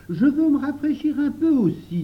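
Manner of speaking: put-on loud, high boyish call; high-pitched eager child; eager demand. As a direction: pipe up loudly to join in. Louis eagerly joins in.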